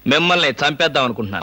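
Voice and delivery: low-pitched, measured menace; deadpan threat. Acted. Continues deadpan threat to kill.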